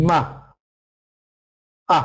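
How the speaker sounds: "Ma" then "a", short separate bursts; isolated short vowel. Shown as a demonstration.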